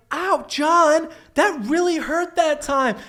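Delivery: high falsetto fake whimper, slow on the end; fake-hurt girl. Classmate faking pain to prank John, high hammed-up whine. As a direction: play a schoolgirl faking injury for laughs, high and hammed-up. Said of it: "she'd fall down and be like".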